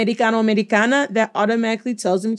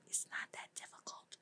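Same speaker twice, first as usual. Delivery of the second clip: barely audible teasing whisper; hushed, voiceless encouragement; whispered aside; 32 dB quieter than usual. Shown as a demonstration. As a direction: whisper it like a secret reminder.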